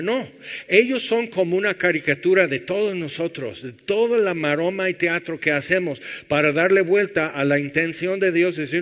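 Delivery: a pastor preaching with rising fire; full-force preaching. Acted. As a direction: preach it hard to the congregation.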